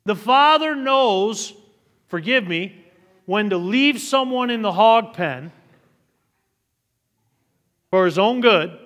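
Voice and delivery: loud, high, drawn-out preacher's climb; preaching at full force. Acted. Pastor preaching to the church, loud and climbing. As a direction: lift the congregation, hammering each phrase.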